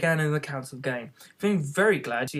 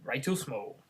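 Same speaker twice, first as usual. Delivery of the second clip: usual speaking voice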